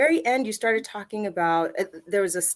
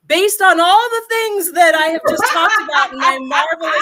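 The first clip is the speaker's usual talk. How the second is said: mock grand outburst; 8 semitones higher, 7 dB louder than usual. Real, not acted. Mock self-praise of presentation.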